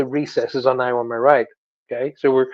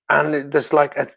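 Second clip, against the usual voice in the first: ordinary delivery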